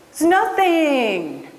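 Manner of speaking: high, slow, dismissive brush-off; playfully imitating a humble person; mock modest shrug. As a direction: wave off the praise like it's nothing. Mimicking humble people brushing off praise.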